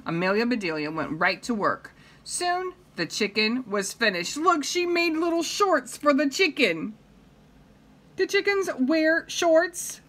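plain reading voice

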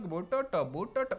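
mock gibberish accent. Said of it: "We don't talk like".

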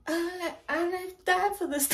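normal talking voice

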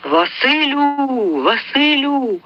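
high, slow drawn-out call. A narrator's reading. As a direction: call across the distance. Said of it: "почав гукати". (протяжне гукання)

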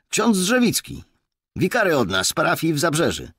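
put-on high, loud young officer; constable informing. A narrator's reading. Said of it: "poinformował funkcjonariusz". (wysoki, głośny głos funkcjonariusza)

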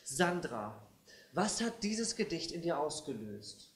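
low, soft and searching; solemn teacherly murmur; hushed, earnest question. Acted. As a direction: ask gently, still under the poem's spell. Teacher still in poetic mood.

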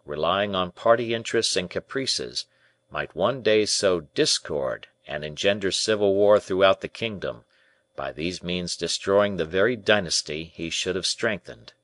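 ordinary delivery, nothing marked

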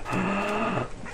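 quick surprised gasp. Real, not acted. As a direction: gasp at the discovery. Spots a village.

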